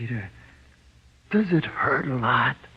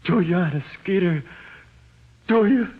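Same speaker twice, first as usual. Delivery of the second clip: high, louder, urgent questions; clinging to any hope; anxious pleading; 7 semitones higher, 3 dB louder than usual. Acted. Prisoner presses Skeeter for reassurance, high and urgent.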